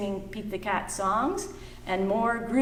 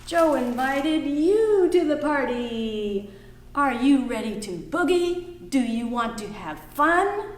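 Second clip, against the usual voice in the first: put-on reading to children; 3 semitones higher than usual. Teacher reading a picture book aloud to the class.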